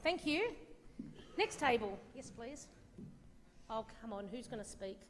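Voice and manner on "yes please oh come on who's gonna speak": ordinary delivery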